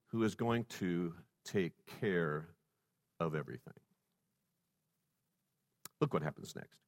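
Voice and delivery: hushed foreboding; ominous hush. Real, not acted. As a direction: drop the voice for suspense. Dramatic drop before turn.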